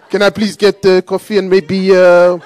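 put-on slow, drawn-out French accent; mock French accent. Customer ordering from French barista, slow mock French accent. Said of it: "I just started doing his accent".

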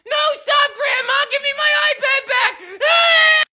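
put-on high, loud protesting boy; screaming kid. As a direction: shriek as the iPad is taken. Kid loses his iPad.

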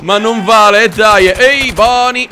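loud, indignant complaint; protesting shout. Real, not acted. Player under attack crying foul, protesting shout.

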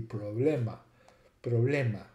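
problema said "pro-BLEH-ma"; Latin pronunciation. Shown as a demonstration. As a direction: say problema the Latin way, ending in a clear A. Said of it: "the Latin pronunciation will have an A".

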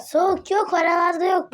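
high teasing call. Real, not acted. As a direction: taunt the seeker.

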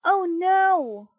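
high, loud, dismayed wail; horrified puppet's exclamation; crying out. A narrator's reading. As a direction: wail in sudden alarm. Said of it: "cried Pinocchio".

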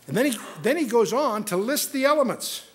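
usual speaking voice

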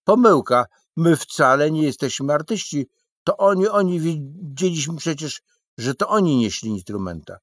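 heated protest. Acted. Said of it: "gorączkował się dalej".

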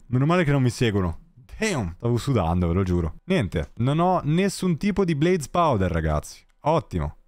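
ordinary talk, nothing marked

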